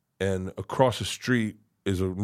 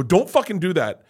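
higher, louder scolding; stern rebuke; 8 semitones higher, 6 dB louder than usual. Retold or imitated. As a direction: re-enact a firm telling-off. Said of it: "And I go".

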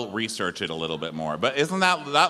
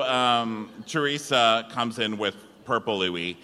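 ordinary talk, nothing marked

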